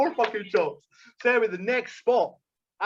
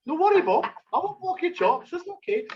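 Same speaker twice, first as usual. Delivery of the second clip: mock meek reply; 10 semitones higher than usual. Telling the podcast how he answered Holly, high and meek. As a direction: squeak it out sweetly, playing harmless. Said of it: "So I was like".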